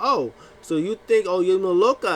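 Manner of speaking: high and loud, mock offended; haughty diva. Zelina snapping back at booing fans, high and loud. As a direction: scold them like a diva.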